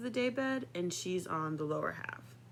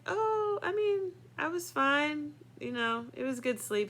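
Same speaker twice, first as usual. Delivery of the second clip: higher, airy nothing-happened voice; high, bright fake-casual reply; 8 semitones higher than usual. Kid dodging the father's question, high and breezy. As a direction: act innocent, high and light. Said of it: "And I was like".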